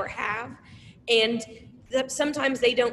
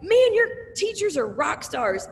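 enthusiastic fan; 7 semitones higher, 5 dB louder, slower than usual. Retold or imitated. Customer praising teachers.

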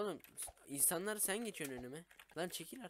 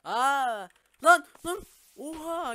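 startled shout; 11 semitones higher, 16 dB louder, much slower than usual. Real, not acted. Startled by jumping character.

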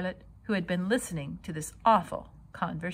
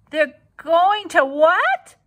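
high shocked scream; 9 semitones higher, 10 dB louder, slower than usual. Acted. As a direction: shriek it in disbelief. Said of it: "screamed Wilbur".

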